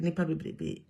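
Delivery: ordinary delivery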